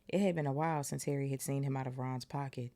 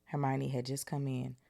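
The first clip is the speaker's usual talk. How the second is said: usual speaking voice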